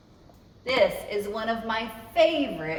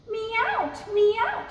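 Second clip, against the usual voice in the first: high, plaintive kitten meow; cat meowing; 12 semitones higher than usual. Acted. The cat complaining at the narrator, high meows. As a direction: cry like a cat wanting in. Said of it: "she cried".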